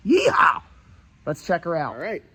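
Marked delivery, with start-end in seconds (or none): 0.1-0.6 loud, high cowboy whoop; excited yeehaw. Real, not acted. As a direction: whoop it out like a rodeo cheer. Cheering at the next truck.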